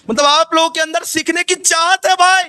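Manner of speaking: high, emphatic teacher's outburst; emphatic exclamation. Shown as a demonstration. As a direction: call it out high and insistently. Teacher exclaims the point to students.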